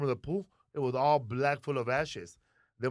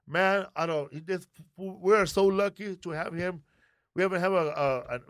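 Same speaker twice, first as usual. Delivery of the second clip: usual speaking voice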